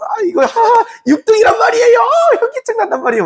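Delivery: very high, hammed-up tearful whine; whining farmer. Farmer begging the official for the lowest grade, mock tearful whine. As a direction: plead in an exaggerated high squeal.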